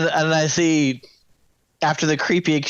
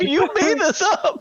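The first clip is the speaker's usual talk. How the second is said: incredulous; 13 semitones higher, slower than usual. Real, not acted. Arguing over the chicken.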